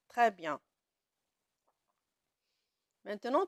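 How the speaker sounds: ordinary delivery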